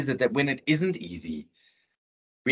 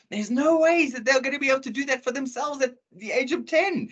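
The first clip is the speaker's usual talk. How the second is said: high-pitched, incredulous protest; exasperated disbelief pitched way up; 12 semitones higher than usual. Real, not acted. Exasperated aside about parents and projects.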